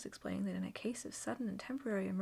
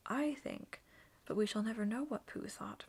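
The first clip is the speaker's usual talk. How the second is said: usual speaking voice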